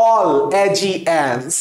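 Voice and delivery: "all এ জি এনস" very slow, each piece apart; slow chanted "all · এ · জি · এনস"; repeat-after-me pronunciation. Shown as a demonstration. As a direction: lead a class through "all এ জি এনস", stretching every piece. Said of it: "কিভাবে উচ্চারণ করে পড়বো".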